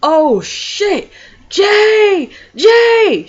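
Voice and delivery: high, loud panicked scream of his name; retold scream. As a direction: shriek it out like she just saw something huge. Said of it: "And she screams".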